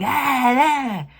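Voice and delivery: high, loud hammed-up Russian villain yells; mock rhino grunts. Reviewer mocking the movie's Russian rhino villain, with loud high hammed-up yells. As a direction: ham up the villain's battle cries. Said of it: "a guy on the street going".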